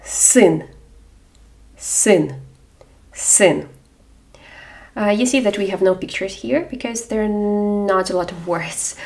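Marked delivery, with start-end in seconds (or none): 0.1-3.7 син modelled, clear и; careful Ukrainian word. Shown as a demonstration. Teacher models син for learners. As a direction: say син crisply. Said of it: "або".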